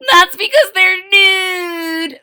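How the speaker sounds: high, loud, drawn-out character voice; silly little sister. A narrator's reading. Jujubee jokes about noodles with her sisters, silly high voice.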